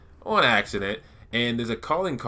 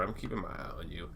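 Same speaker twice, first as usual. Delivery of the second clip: quiet warning aside; 3 semitones lower, 11 dB quieter than usual. Real, not acted. Warning Viper under breath.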